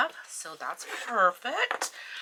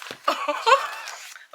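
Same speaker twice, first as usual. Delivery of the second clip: very high squealing laugh; giddy shriek of a laugh; 6 dB louder than usual. Real, not acted. Laughing at being so off.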